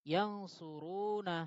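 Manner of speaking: ইয়ানসুরুনা stretched out slowly; ইয়ানসুরুনা drawn out, each syllable clear; slow drawn-out pattern word. Shown as a demonstration. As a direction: sound out ইয়ানসুরুনা like a teacher. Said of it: "সিগাটা কি?".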